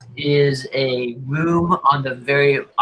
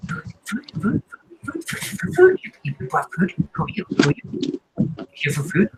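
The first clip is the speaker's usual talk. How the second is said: high, breathy, crazed whispering voice; insane whisper voice; 5 semitones higher than usual. Acted. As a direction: whisper like a starving, half-mad thing. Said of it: "a voice, kind of insane, a little confused".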